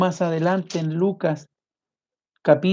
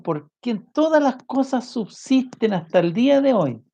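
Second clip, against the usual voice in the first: fervent preaching; 7 semitones higher, 3 dB louder than usual. Acted. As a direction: proclaim His greatness with rising fervor. Preaching at full force on Jesus.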